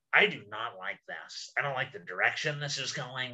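low, quiet, slow mock dismay; mock uneasy. Host worried about where the show is heading, low and quiet. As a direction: mutter your doubts half to yourself.

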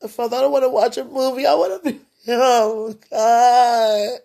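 high, loud, fast crying plea; begging through tears; tearful plea. Real, not acted. Woman pleading with Father through sobs.

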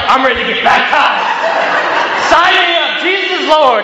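acted-out excitement, pitched high; retold eagerness, high and emphatic; eager convert. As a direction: jump at it like a keen new recruit. Retells eager decision to be baptized.

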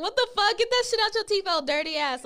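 loud, high-pitched mock outrage; mock disgusted outburst. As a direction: act out a rude, loud call-out. Acting out a rude tease.

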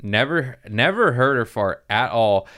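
ordinary talk

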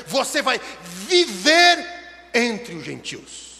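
loud, driving pulpit exhortation; forceful preaching, key words stretched out; impassioned preaching. Acted. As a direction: hammer it home to the congregation.

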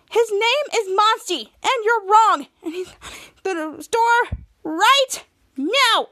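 high, emphatic mock defiance; defiant retort. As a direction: declare it like an acted hero. Hero's defiant retort, acted.

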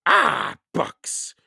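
disgusted pirate growl, loud at first; growling Captain Bones. A narrator's reading. Captain Bones hears there are only books, growling. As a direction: growl it, starting with a loud outburst.

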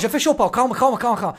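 loud, urgent "calm down". Real, not acted. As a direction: talk over the fight to calm it. Calming a heated argument, loud. (acalmando a discussão em voz alta)

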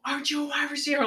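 high, fast angry coach bark. Retold or imitated. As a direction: demand it like a furious coach. Angry coach yelling at player.

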